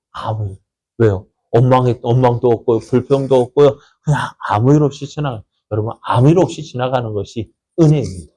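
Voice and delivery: ordinary talk, nothing marked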